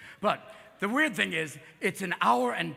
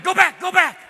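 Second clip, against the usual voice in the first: louder and higher, an eager "go back, go back"; a guy begging the tape to rewind, hammed-up; urgent rewind plea; 10 semitones higher, 12 dB louder than usual. Rewinding the porn, calling out.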